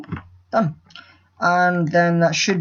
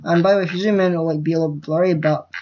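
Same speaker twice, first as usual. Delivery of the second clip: ordinary talk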